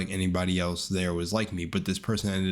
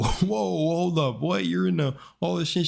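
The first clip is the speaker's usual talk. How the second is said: retold surprise; 9 semitones higher than usual. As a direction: act out being pleasantly thrown, voice jumping up. Retold surprised reaction to her.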